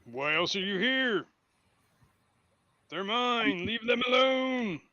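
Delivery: slow, rising monster challenge; indignant monster. Acted. Monster answering the treasure-denying intruders, slow indignant demand.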